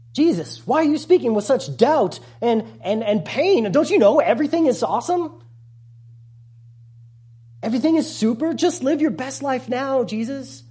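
high, bright, hammed-up cheerfulness; sarcastically chirpy, brushing off pain; mock cheerful preacher. Mocking a feel-good preacher.

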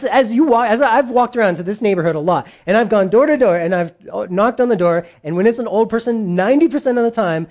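ordinary talk, nothing marked

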